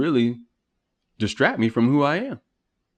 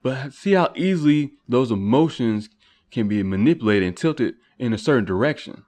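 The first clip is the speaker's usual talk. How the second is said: ordinary talk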